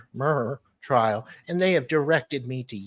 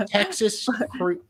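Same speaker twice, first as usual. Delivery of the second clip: amused repeat; 9 semitones higher, 3 dB louder, slower than usual. Real, not acted. Repeating the funny name.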